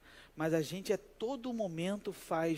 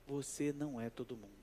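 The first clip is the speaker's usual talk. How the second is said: quiet emphasis; 3 semitones lower, 10 dB quieter than usual. Shown as a demonstration. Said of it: "Sabe o que que Jesus tá dizendo".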